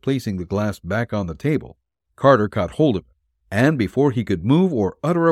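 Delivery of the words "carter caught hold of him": ordinary delivery, nothing marked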